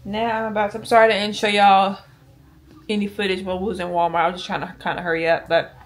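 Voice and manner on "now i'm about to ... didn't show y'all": usual speaking voice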